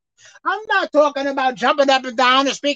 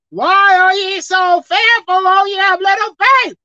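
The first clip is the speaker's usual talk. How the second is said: slow, loud, high scriptural rebuke; solemn rebuke; 7 semitones higher, 6 dB louder, slower than usual. Retold or imitated. Jesus rebuking the fearful disciples, slow loud solemn. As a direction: draw out the question in solemn reproach.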